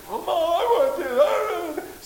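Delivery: high mock whining child voice, near crying; high, teary, hammed-up child's whine; whiny child. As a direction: whine it like a spoiled six-year-old begging mom. Preacher mocks a whining child.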